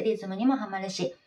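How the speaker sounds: ordinary talk, nothing marked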